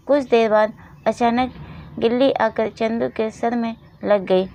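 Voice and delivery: ordinary delivery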